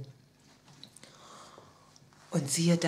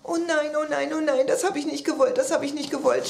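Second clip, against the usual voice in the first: high, repetitive flustered pleading; panicked stammering; 7 semitones higher, 4 dB louder than usual. A narrator's reading. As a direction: stammer out the words in acted distress. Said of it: "stammelte kleine Sping". (aufgeregt und verzweifelt stammeln)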